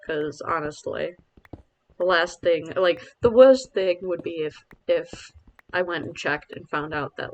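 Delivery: normal talking voice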